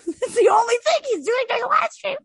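very high, squealing through laughter; laughing. Real, not acted. Host mocking the live stream, high laughing. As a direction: crack up all through the line. Cracking up after her impression.

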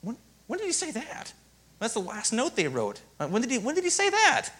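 hammed-up bewildered note-taker; mock confused student. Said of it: "I'd be like".

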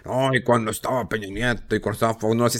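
low, quiet mumbled excuse; mock defensive excuse. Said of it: "Y, y siempre la defensa".

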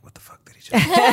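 high, unvoiced whisper with a laugh. Aside to fellow comedians backstage, stunned laughing whisper. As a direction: whisper it to the other comics, giggling. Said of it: "I was looking at the other comedians".

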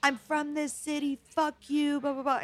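high-pitched mock yell of a drunk customer; a drunk giant screaming at the bartender, mock-angry and high; retold screaming. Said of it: "started just screaming in my face".

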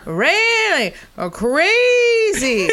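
high, slow, drawn-out mock amazement; hammed-up impression of a friend's voice; impression of her friend. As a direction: gush like a wide-eyed fan. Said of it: "Just going".

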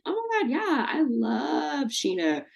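a mocking fake-sweet wife; sneering at the idea of Brandy welcoming Scheana; mock Brandy gushing. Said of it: "This woman's not gonna be like".

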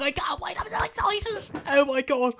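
tiny, very high squeal of glee; hammed-up giddy dog owner; excited squeal. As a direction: squeak it softly, barely containing yourself.

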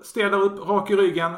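loud, high, commanding order; imitating Darkseid telling them off; scolding bark. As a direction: bark it like a drill sergeant. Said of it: "han skäller ut dem".

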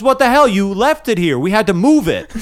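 a hint of a fed-up fire chief; irritated chief. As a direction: scold him for leaving it. Annoyed fire chief replies.

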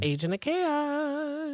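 slow, drawn-out movie-trailer line; slow mock trailer voice; dramatic announcer. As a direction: intone it like a title card. Dramatic label for Villanelle.

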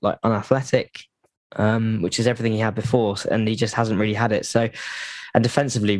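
usual speaking voice

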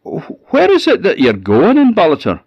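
shouted high and loud; shouting after him. A narrator's reading. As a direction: call out loudly after the coach. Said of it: "he shouted after the coachman".

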